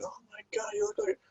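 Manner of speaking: put-on very high, soft old-lady voice; gushing old lady. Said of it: "it's like this old lady".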